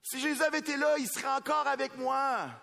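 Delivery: high, loud pleading cry; a grieving relative crying out to the pastor; grieving plea. Retold or imitated. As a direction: voice the anguish of a mourner. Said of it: "qui me regardent en disant".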